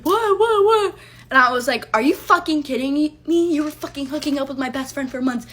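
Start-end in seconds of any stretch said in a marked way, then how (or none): 0.0-0.9 very high, loud squeaky "what, what, what"; mock his panicked innocence in a squeal; mocking the boyfriend. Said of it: "He was like". 1.9-5.5 retold yell. Retold quarrel, yelling at boyfriend.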